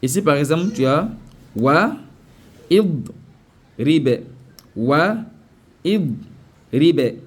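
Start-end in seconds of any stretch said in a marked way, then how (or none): 1.5-7.2 "wa id ribe" in separate pieces, the "id" voiced; wrong split reading. Shown as a demonstration. Teacher shows pupils the bad reading, split word by word. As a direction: read "wa", "id", "ribe" apart, each on its own. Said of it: "c'est pas une bonne lecture".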